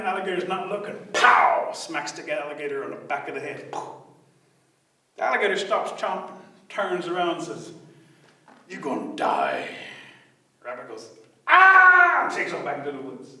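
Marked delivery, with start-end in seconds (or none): 1.1-1.7 loud, explosive pow; sudden cartoon impact; pow sound. A narrator's reading. Imitated smack. 8.7-9.7 very low, slow threat; deadly slow gator voice; menacing alligator. Acted. Angry alligator threatens rabbit. 11.5-12.2 high, loud cartoon scream; sudden piercing yell; frightened scream. Rabbit screams, flees.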